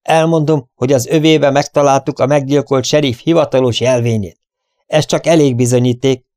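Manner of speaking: loud, emphatic announcement. A narrator's reading. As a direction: declare the proof to the whole room. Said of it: "majd hirtelen felemelte a hangját".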